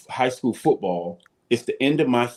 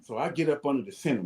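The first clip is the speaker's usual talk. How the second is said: ordinary talk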